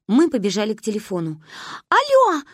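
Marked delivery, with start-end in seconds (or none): 1.9-2.4 very high, loud phone shout; child's yell. A narrator's reading. Manya yells into the phone to dad, shrieked greeting. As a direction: bellow it into the receiver like a panicked kid. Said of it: "проорала Маня в трубку".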